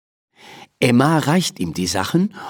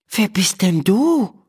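put-on higher, lightly surprised girl; astonished; 9 semitones higher than usual. A narrator's reading. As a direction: ask with mild wonder. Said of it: "fragt Emma erstaunt". (erstaunt, mit heller Mädchenstimme)

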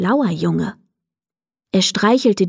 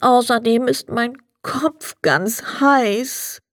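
weary, congested plea; sad, sick Sandman; 3 semitones higher than usual. A narrator's reading. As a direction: droop with tiredness and a feverish cold, dragging the last words. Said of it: "ließ den Kopf hängen und sagte traurig".

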